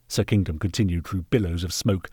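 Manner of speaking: plain reading voice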